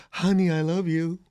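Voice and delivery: lightly voiced sweetheart; mock sweet partner. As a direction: coo it like a doting spouse. Mocking expected sweet reply.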